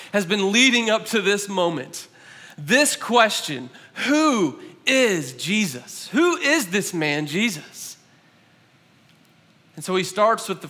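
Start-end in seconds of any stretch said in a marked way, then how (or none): 2.6-8.0 drawn out and grave; slow, weighty question. Acted. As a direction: lean on the word who slowly. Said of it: "preacher stresses key question".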